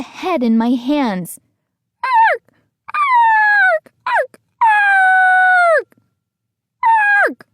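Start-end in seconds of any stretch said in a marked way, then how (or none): 2.0-7.5 shrill, loud mechanical cackle; robot laugh. A narrator's reading. Robot head keeps cackling at the boy, shrill and loud. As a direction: screech it like a broken robot laugh. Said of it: "the laughter didn't stop".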